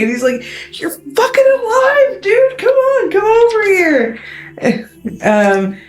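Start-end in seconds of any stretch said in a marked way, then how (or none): 0.8-4.2 very high, thrilled bro voice; squeaky-high, overjoyed disbelief; shocked excitement. Acted. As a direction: greet a friend back from the dead. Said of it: "like, shocked".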